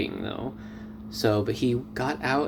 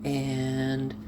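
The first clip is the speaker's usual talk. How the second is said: usual speaking voice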